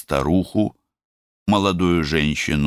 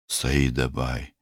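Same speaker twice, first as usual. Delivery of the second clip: ordinary delivery, nothing marked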